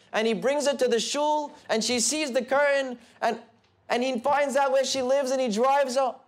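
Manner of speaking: building excitement. Real, not acted. Preacher marvels at how Hashem arranged every step, with rising excitement. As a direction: pile up the chain of events with awe.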